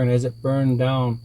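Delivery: ordinary delivery, nothing marked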